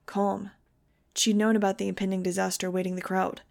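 usual speaking voice

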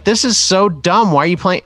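retold annoyance. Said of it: "they were just like".

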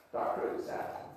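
hushed, breathy aside; whispering the phrase like a sly aside; whispered. Shown as a demonstration. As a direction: drop to a secret whisper. Repeated phrase, second take hushed.

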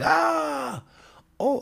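high shrill mock yell of the aunties; imitated auntie yell. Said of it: "like, yelling at her".

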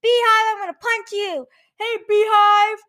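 high-pitched mock taunting call. Trying out Beehive as a first name, mock calling out.

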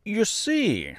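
high, mildly confident opener; self-assured straw man; confident scarecrow. A narrator's reading. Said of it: "he continued confidently".

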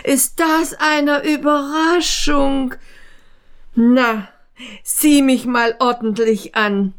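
put-on thin man marvelling at his old friend; thin man gushing. A narrator's reading. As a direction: gush with surprise and flattery.

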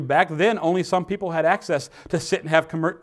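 ordinary talk

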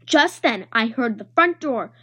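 usual speaking voice

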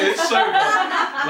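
slow, high and laughing. Real, not acted. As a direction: stretch it out while laughing. Laughing, echoing friend.

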